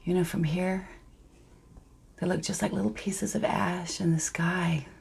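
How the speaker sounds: very soft, half-whispered murmur; quietly, under breath. Acted. Claire watching the birds leave, quietly, almost under her breath. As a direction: let it fade almost to a whisper. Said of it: "quietly, almost under her breath".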